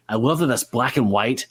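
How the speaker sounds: ordinary talk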